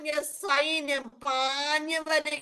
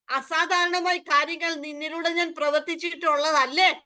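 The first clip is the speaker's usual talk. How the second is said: ordinary talk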